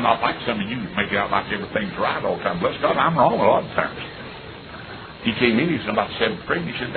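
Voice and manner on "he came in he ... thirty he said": ordinary talk, nothing marked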